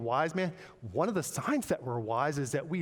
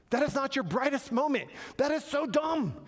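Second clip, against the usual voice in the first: high-pitched, emphatic mock telling-off; God scolding from above; 10 semitones higher than usual. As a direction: scold them to get their attention, playfully overdone. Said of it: "God's looking down, he's going".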